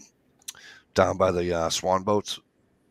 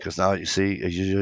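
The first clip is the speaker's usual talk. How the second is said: ordinary talk, nothing marked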